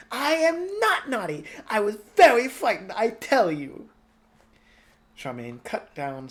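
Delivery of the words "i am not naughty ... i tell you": put-on loud, much higher child's yell. Twinkle protecting himself from blame, loud high yell. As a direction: yell indignantly like a scolded boy. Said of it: "Twinkle was yelling".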